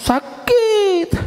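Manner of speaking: high, whimpering cry of pain. Acted. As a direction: whine like it really hurts. Slipped off tree, hurt. (merintih kesakitan)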